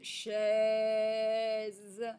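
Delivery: CHAISE stretched very long on è; elongated vowel. Shown as a demonstration. Teacher lets pupils hear è, "chaise" slowly stretched. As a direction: draw out the middle of "chaise". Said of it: "c'est le son est".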